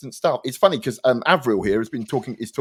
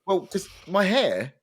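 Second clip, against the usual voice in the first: normal talking voice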